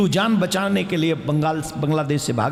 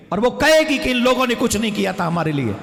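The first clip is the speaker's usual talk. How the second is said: ordinary talk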